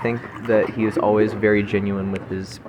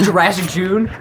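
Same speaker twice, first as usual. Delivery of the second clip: incredulous echo; 9 semitones higher, 5 dB louder than usual. Real, not acted. Echoing a silly poll option.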